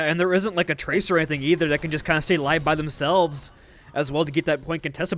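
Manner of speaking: normal talking voice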